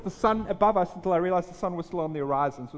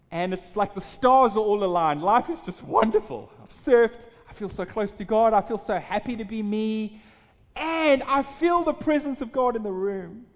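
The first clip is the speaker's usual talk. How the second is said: louder, higher rush of joy; joyful elation; 4 semitones higher, 6 dB louder than usual. Retold or imitated. Preacher recalls a perfect night, rising joy. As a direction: swell up with happiness in the telling.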